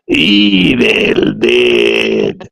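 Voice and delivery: ordinary talk, nothing marked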